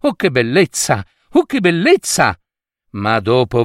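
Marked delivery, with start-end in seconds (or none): 0.0-2.4 admiring. A narrator's reading. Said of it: "e come lo ammirava".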